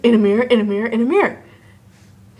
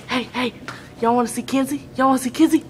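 ordinary talk, nothing marked

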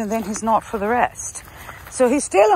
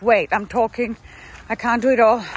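ordinary delivery

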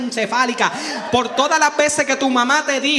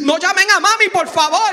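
loud, higher urgent plea; begging boy; 7 semitones higher, 7 dB louder than usual. Retold or imitated. Injured boy begging teachers, loud high plea. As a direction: beg them not to call mom.

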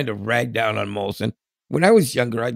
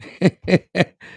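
high laugh; 9 semitones higher, 6 dB louder than usual. Real, not acted. Laughing at own joke.

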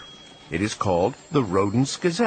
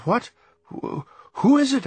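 high, startled stammer; 12 semitones higher, 3 dB louder than usual. A narrator's reading. Sleepy mouse answering a shrieking caller, stammering. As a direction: stumble over the words in a high voice. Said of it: "I stammered".

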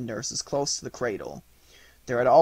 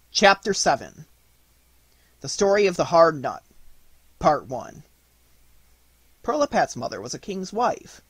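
usual speaking voice

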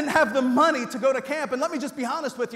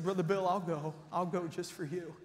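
tender; 6 semitones lower, 10 dB quieter than usual. Retold or imitated. Boy agreeing, moved.

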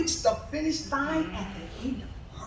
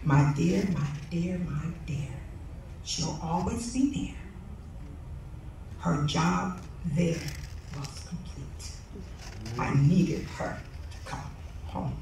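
hushed, gentle, much softer divine voice; soft voice of God; 9 semitones lower, 9 dB quieter than usual. Acted. God answering the grieving speaker, soft and tender. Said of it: "He said in so soft in a voice".

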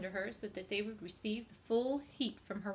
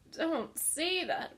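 slow, higher, louder pained groan of a piglet; groaning plea; 7 semitones higher, 5 dB louder, much slower than usual. Acted. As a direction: drag it out in dismay. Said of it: "groaned Wilbur".

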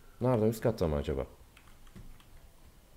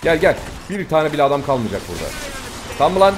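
loud and higher, calling enemies on; yelling; 5 semitones higher, 7 dB louder than usual. Real, not acted. Gamer yelling at the enemies in the restaurant, loud shout. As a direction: shout them into the fight.

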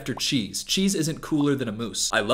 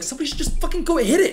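higher, fast, exasperated urging; impatient inner voice; 13 semitones higher, faster than usual. Retold or imitated. Commentator imitating his own impatience, fast and high. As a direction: blurt out the frustration fast. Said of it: "I'm always like".

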